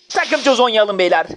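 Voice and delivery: ordinary talk, nothing marked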